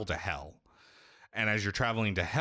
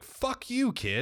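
mock outburst; slower than usual. Player ranting at Atreus, mock yell. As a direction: yell it at the kid.

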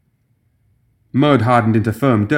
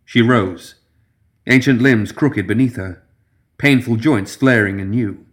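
ordinary delivery, nothing marked

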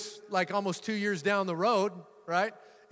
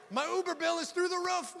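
high, laughing complaint to God; mock pleading prayer, pitched up; mock plea; 11 semitones higher, 4 dB quieter than usual.

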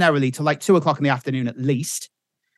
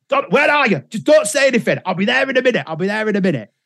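raised, insistent acted voice; high at first, settling as he promises; urgent husband; 7 semitones higher, faster than usual. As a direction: imitate a flustered man taking charge. Husband urgently answers scared wife.

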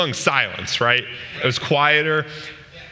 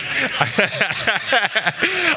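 laugh; 9 semitones higher than usual. Real, not acted. Still laughing at his story.

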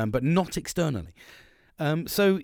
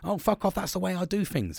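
higher, brushing-off snap; retelling his own defiant brush-off; dismissive retort; 7 semitones higher than usual. Retold or imitated. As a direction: wave them off defiantly. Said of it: "you've probably heard me say".

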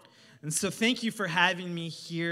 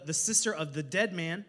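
ordinary talk

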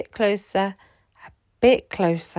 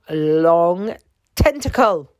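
ordinary delivery

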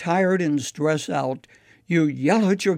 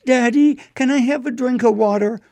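put-on higher and louder, a small boy calling from bed; little boy calling; 8 semitones higher, 6 dB louder than usual. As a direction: call out to Daddy like a little boy from his bedroom. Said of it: "he called out".

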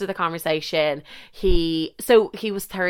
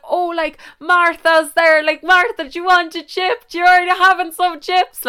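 high, louder put-on voice offering chips; imitating the guy; 11 semitones higher, 6 dB louder than usual. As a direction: play him calling over the din. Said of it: "he's like".

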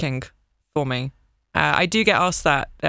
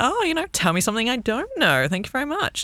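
sassy, pitched much higher with a falling tease on "Oh"; 8 semitones higher than usual. Shown as a demonstration. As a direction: give it sass and saunter off. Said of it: "putting a little bit of sass in it".

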